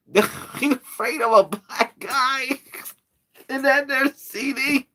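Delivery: high laughing repeat of the line; cracking up repeating the pitch. Real, not acted.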